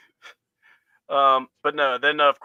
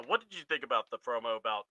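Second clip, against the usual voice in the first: ordinary delivery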